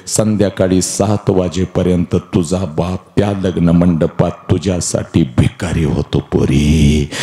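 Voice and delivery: ordinary talk, nothing marked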